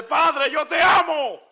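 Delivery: high, slow shout of love to God; slow high shouted declaration; cry of love. Shown as a demonstration. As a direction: call out to the Father.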